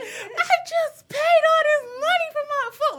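high, loud wailing complaint; hammed-up, whiny outrage; comically anguished mock yell; pissed off. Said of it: "And I'm pissed".

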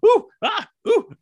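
very high, loud mock startled ooh and ah; startled yelps. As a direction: squeal like someone who keeps getting spooked. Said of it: "she makes a jumping, startled noise".

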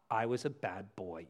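low, quiet quoting back; disapproving echo. Retold or imitated. Throwing the offender's words back at them, low and disapproving. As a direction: repeat the excuse low and soft, unimpressed. Echoes offender's excuse in his rebuke.

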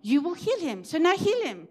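acting out a firm, heartfelt challenge in prayer; bold faith. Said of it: "He stood in faith".

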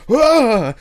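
high, loud startled cry; imitating a scared player's gasp; startled yelp. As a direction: jump like someone scared you. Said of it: "Like that".

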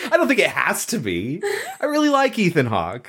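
talking through real laughter, pitched high; laughing. Real, not acted. Fan defending Ethan Hawke to co-host, laughing. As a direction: protest while still giggling.

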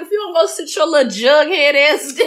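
high, loud scolding; exasperated scolding. Retold or imitated. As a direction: tell him off, exasperated. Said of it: "I was like".

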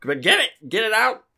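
high frantic squeal in short bursts; panicked pleading. Acted. Character begs for spider's removal.

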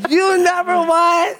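bigger hype. Real, not acted. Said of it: "Here we go".

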